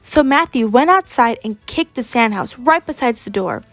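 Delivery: ordinary delivery, nothing marked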